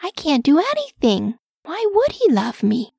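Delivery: high, insecure little character voice; puzzled self-doubt; doubtful questioning. Acted. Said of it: "he questioned".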